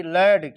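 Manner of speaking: लेड with open 'ae', like lad; correct reading of lad. Shown as a demonstration. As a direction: say लेड to rhyme with bad. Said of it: "ये नहीं पढ़ेंगे".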